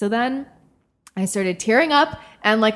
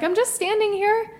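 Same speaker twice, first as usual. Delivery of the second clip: pitched well up, baffled protest; mock innocent retort; high defensive protest; 13 semitones higher than usual. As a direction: squeak it out in disbelief. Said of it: "That's what I said".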